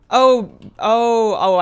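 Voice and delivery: normal talking voice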